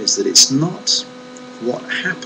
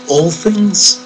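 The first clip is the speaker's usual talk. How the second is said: incredulous question; 6 semitones higher, 4 dB louder than usual. Pretend.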